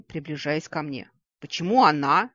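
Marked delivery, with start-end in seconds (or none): 1.4-2.3 loud, jealous protest; indignant, jealous outburst; jealous cry. A narrator's reading. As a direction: cry out, stung that she was chosen. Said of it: "ревниво вскрикнула Ладиина".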